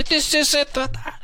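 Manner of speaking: high, huffy mock threat; threatening homeowner. Homeowner threatening to call the cops, high huffy voice. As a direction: puff up like a petty tyrant.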